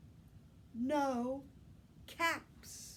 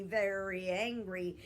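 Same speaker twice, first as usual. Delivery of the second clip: ordinary delivery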